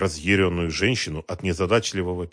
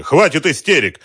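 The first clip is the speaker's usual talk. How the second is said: loud, raised-pitch rebuke; sharp shout; 9 semitones higher, 12 dB louder than usual. A narrator's reading. Man stopping a hysterical woman, loud angry rebuke. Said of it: "крикнул он".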